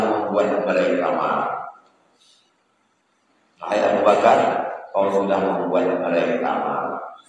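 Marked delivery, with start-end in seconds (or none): none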